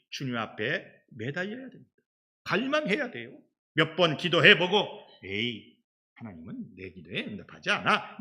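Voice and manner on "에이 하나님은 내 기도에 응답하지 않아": grumbling dismissal. Retold or imitated. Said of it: "이러면 안 돼요".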